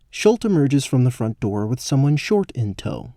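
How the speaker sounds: ordinary talk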